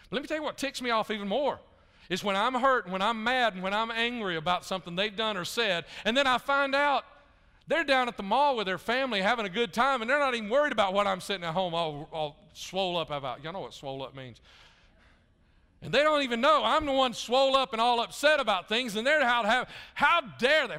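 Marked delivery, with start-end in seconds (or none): 0.1-13.4 louder, higher preaching build; fiery preaching. Acted. Pastor to his congregation about grudges, fiery preaching. 15.8-20.8 loud, high, indignant preaching; preaching at full force. Acted. Pastor to his congregation, mock outrage at full preaching force.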